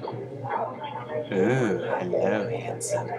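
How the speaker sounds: low, soft, seductive purr; smitten reply. Acted. Woman flirting back at the man in the bar, smitten. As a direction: answer softly and low, taken with him. Woman answers the Russian-accented pickup line.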